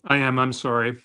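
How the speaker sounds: talking while chewing; mouth full. Real, not acted. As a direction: speak through a mouthful of tart. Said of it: "you're chewing on?".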